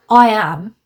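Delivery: loud, firm "I am"; emphatic I am. Shown as a demonstration. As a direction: declare it, no doubt. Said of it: "There's no room for doubt in I am".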